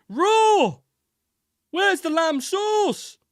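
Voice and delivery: high, loud, drawn-out chef's yell; Gordon Ramsay impression. Shown as a demonstration. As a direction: holler like a furious TV chef, played for laughs. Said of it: "transition humorously into Gordon Ramsay impression".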